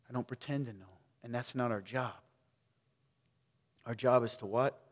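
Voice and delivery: ordinary delivery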